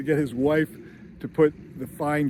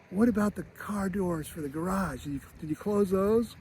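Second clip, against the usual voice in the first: higher, softer fretting mom; worried mom; 5 semitones higher, 4 dB quieter than usual. Retold or imitated. Mom pressing dad about the garage, higher fret. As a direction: nag gently like an anxious mother. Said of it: "She's like".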